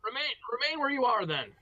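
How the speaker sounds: high-pitched shouted order; enemy calling out. Acted. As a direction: call across to hold them in place. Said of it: "he said".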